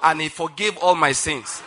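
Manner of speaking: loud, measured proclamation with pauses; preaching declaration. Acted. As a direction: declare it line by line for a congregation to repeat. Leads congregation in declaration.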